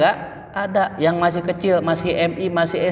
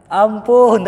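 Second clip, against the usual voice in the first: begging for mercy; 4 semitones higher, 7 dB louder than usual. Retold or imitated. Thief finally begging.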